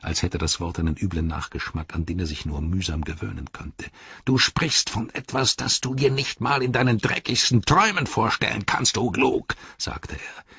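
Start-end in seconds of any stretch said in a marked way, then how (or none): 4.2-9.5 high orc voice, offended; high, scornful orc; Grishnach. Acted. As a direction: rebuke the rival in a raised pitch. Grishnach rebukes Ugluk.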